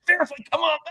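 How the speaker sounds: high, chirpy call; lightly voiced cheerful doctor. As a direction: call him cheerfully from the door. Doctor calls him back.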